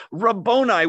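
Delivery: high-pitched exclamation of recognition; crying out. Retold or imitated. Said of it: "turned toward him and cried out".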